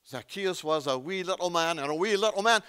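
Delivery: lilting Sunday school rhyme in Scottish; Scottish accent. Acted. Preacher reciting a Sunday school song to his congregation, Scottish accent. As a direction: put on a playful Scottish lilt. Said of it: "to do the Scottish thing on you".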